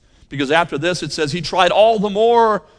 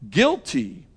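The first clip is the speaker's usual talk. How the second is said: verdict repeated; 3 semitones higher than usual. Retold or imitated. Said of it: "God's verdict upon his son".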